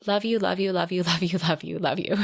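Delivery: love you over and over in a rush; rapid repetition. Acted. Ian pours out his love to Beth, rapid repetition. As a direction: run the declarations together without a pause. Character repeats the words rapid-fire.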